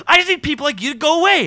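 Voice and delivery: high, loud acted snap; mock irritated outburst. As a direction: yell at them to leave, hammed up. Acting out Chloe snapping at colleague.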